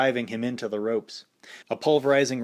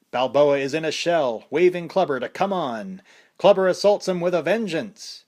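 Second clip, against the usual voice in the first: excited ringside announcer, higher and louder; boxing announcer calling the action; fight commentator; 6 semitones higher, 5 dB louder than usual. A narrator's reading.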